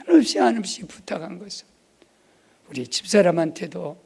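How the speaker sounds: usual speaking voice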